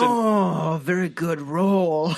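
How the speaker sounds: slow, drawn-out mock praise; gatekeeper's reaction. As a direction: imitate the game host reacting to a roll in a put-on voice. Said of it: "he did a dumb fucking reaction".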